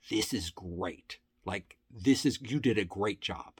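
low, quiet, slow-starting praise from a gruff boss; understated imitation of an intimidating man. Intimidating man praising the storyteller's research, low and quiet. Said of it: "he's a very intimidating guy".

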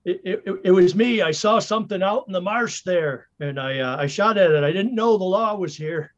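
high, flustered confession; stammered apology. Acted. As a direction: stumble through an apology to the police. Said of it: "I go".

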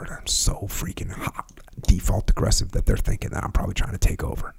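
hushed, breathy whisper, mocking the asker. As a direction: whisper it like a secret grievance, half-joking.

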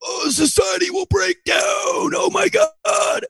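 high, slow shrieking panic; hammed-up doomsday shriek; mock panic.

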